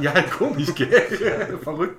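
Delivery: high, loud laughing words. Real, not acted. Laughs at nickname.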